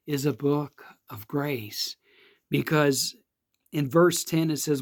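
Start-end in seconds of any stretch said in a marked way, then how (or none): none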